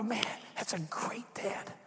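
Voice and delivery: slow, half-whispered marvel; hushed admiration. Acted. Family member looking at dad and marvelling, hushed admiration. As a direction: drop to a soft, breath-only murmur of respect.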